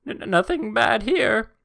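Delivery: stammering high, loud squeak; very high, drawn-out adolescent voice; pubescent boy voice. Retold or imitated. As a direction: blurt it slowly in a panicked teen squeal. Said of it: "teenage boy voice".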